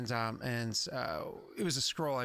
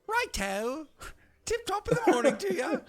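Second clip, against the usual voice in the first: much higher, chirpy hammed-up accent; Neil accent; 6 dB louder than usual. Shown as a demonstration. Game master shows players a terrible accent, hammed-up high accent. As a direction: put on a bad, high-pitched jolly accent. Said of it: "when I do my Neil accent".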